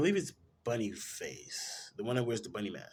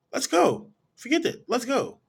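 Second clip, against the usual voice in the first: much higher, louder, urgent; panicked let's go; 12 semitones higher, 7 dB louder than usual. Pretend. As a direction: yell it like you're getting her out now. Imagining his panicked girlfriend.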